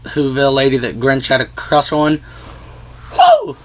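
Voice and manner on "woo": a sudden whoop, very high; excited whoop. Real, not acted. Vlogger cheering the Whoville crush, high whoop.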